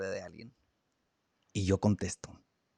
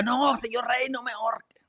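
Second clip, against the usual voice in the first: high pleading cry, as the condemned usually beg; pleading crowd; 10 semitones higher than usual. Retold or imitated. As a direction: beg the king high and urgent. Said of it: "gritaba y decía".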